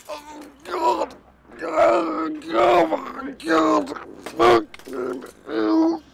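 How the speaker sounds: high, loud shocked cry; pained scream. Acted. Host being attacked crying out in shock. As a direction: cry out in pain after the hit.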